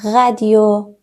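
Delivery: normal talking voice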